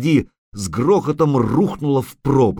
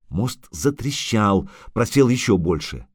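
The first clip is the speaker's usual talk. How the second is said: ordinary delivery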